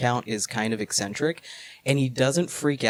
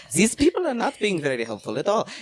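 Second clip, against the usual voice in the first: higher, quick mock German accent; 5 semitones higher, faster than usual. [name] complaining about the villagers, hammed-up German accent. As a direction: put the German accent back on, pitched up. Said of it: "Lost your accent".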